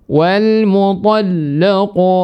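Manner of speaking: "mutallaqu" chanted slow and high, la shaded toward lo; lam wrongly thickened. Shown as a demonstration. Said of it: "lam itu kan rendah".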